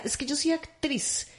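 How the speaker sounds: a hint of a haughty actress insisting; mock proud actress. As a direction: puff up like a vain actress. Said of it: "pero después decía".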